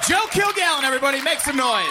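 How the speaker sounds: high, hyped MC call to the crowd; announcer hype. Acted. Host hyping the crowd after a comedian's set. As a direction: pump the crowd up like a club host.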